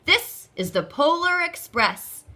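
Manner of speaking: high, loud and grand; a big, jolly conductor's answer; booming conductor. Acted. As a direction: announce it like a proud conductor. Conductor names his train.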